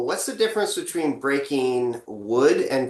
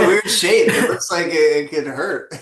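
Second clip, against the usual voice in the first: laughing; 3 semitones higher, 6 dB louder than usual. Real, not acted. Laughing over the baseball bat.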